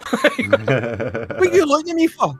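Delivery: very high, loud mock girl's protest; wee lassie. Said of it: "the wee lassie's beside him like".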